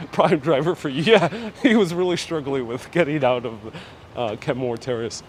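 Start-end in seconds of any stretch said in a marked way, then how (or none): none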